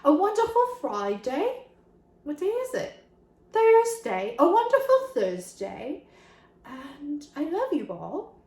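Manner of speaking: playful mock British cheerio; put-on British accent. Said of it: "I have an accent now".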